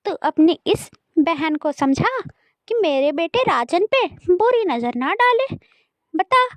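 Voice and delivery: high, dismissive grumbling; exasperated stepmother. Acted. Stepmother warns the brother about his sister, exasperated. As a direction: brush the siblings off crossly.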